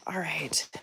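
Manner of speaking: soft breathy whisper. Real, not acted. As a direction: drop to a hush. Quiet filming, whisper.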